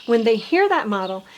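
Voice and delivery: normal talking voice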